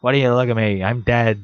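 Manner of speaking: usual speaking voice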